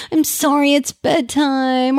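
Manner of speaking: put-on slow, apologetic sorry to the child; mock a parent who says sorry for bedtime; over-apologizing parent. Parent apologizing to child over bedtime, slow and sorry. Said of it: "I don't mean apologize for parenting".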